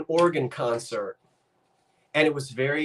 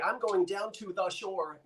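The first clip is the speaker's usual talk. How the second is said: higher, softer outsider's careful phrasing; imitated outsider; 5 semitones higher, 9 dB quieter than usual. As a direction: mimic a non-local, every word separate. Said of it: "if you ain't from here, if you say".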